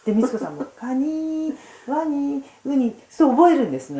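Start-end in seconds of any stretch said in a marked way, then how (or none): 0.8-2.9 カニー、ワニー、ウニー high, each ending drawn out; stretched final syllables. Shown as a demonstration. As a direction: demonstrate the drill, holding the "ee" in カニー、ワニー、ウニー. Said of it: "ちょっと二が伸ばすんですよ". (カニー、ワニー、ウニーの語尾を高く伸ばして手本を示す)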